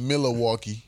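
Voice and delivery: Milwaukee in full, "MILL-uh-walkie"; imitated pronunciation. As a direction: imitate Milwaukee with every syllable sounded. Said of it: "I had a girl used to say".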